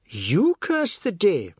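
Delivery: high, loud, slow echo; drawn-out outraged reply; indignant retort. A narrator's reading. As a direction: throw the words back scornfully. Tulsi retorts indignantly in quarrel.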